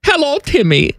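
put-on high, hammy German accent voice. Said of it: "Do you like my German accent".

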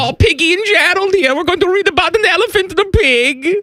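very high storytime German voice; German accent. Pretend. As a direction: read it like a silly German bedtime story. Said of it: "It's my German accent".

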